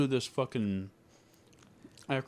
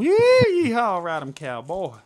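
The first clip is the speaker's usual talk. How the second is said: loud high yeehaw; cowboy whoop; 4 semitones higher, 10 dB louder than usual. Pretend.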